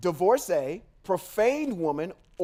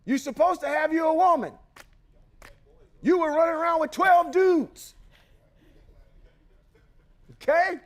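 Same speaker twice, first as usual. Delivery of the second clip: mocking challenge; 7 semitones higher, 3 dB louder than usual. Real, not acted. Challenging the listener's messiah, mockingly.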